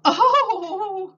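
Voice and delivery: high, loud little gasp of surprise; startled cry. Acted. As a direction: yelp as the antlers catch. Imogene gets stuck in doorway.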